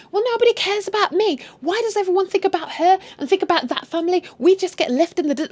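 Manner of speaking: high, whining protest; mock aggrieved arguing. Daughter argues back with her mother, mock aggrieved. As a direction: play the hard-done-by daughter. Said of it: "they're arguing back and forth".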